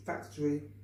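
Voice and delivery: usual speaking voice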